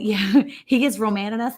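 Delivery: usual speaking voice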